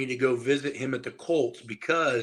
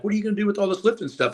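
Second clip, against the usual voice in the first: higher, quick curious question; a stranger quizzing a young lifter, quick and eager; eager quoted question; 8 semitones higher, much faster than usual. Retold or imitated. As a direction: fire off the question fast and bright. Retold guy quizzing him.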